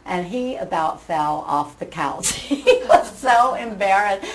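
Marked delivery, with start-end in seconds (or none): 2.3-4.3 laughing through the words, higher and louder; can't hold back the laugh. Real, not acted. Laughing while telling it.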